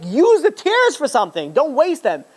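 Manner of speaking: high, pressing plea to use the tears; urgent exhortation. Retold or imitated. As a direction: urge the girls on with rising fervor. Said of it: "I'm like".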